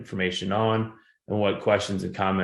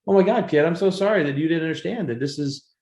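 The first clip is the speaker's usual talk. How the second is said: consoling; 9 semitones higher, 3 dB louder than usual. Retold or imitated. Retelling consoling the student.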